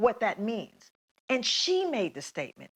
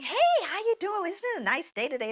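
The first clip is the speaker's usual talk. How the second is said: bright high greeting, much higher pitch; friendly chirpy checker; 10 semitones higher, faster than usual. Retold or imitated. Checker chatting warmly with Kathleen.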